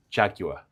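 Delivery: Jaguar said the American way, JAG-you-ar. Retold or imitated. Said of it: "you Americans say".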